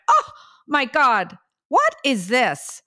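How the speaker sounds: slow, high re-enacted disbelief; stunned surprise. Mother opening a box with her daughter's pregnancy test, stunned. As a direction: draw it out in astonishment.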